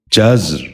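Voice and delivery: جزر said "jazr", no vowel between z and r; z running straight into a rolled r in جزر; correct pronunciation. Shown as a demonstration. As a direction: say جزر as one syllable, "jazr". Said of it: "آپ بھی غور سے سنیے".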